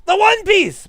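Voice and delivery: high, loud mock anime cry; loud hammed-up excitement; hyped shout. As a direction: belt it like an anime hero. Joking One Piece exclamation.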